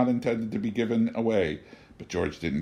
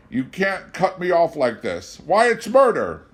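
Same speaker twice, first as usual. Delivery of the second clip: high, loud outcry; desperate protest; 8 semitones higher, 6 dB louder than usual. A narrator's reading. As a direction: protest in panic. George protests.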